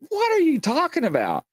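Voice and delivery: high, disbelieving falling question; you think he's lost it; incredulous question. Retold or imitated. Buddy hearing the ball went in the wall, high incredulous disbelief.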